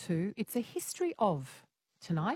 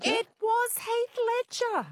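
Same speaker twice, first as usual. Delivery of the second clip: excited reveal; 4 dB louder than usual. Real, not acted. Host confirms answer.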